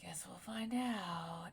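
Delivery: slow, breathy whisper. Real, not acted. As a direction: whisper it, slowly and dryly. Ominous aside about camera.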